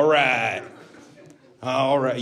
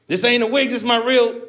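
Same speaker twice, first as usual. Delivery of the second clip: higher, louder hammed-up denial; mock offended protest; 10 semitones higher, 4 dB louder than usual. Defending the wig to the crowd, a higher louder mock protest. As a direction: insist it's real hair.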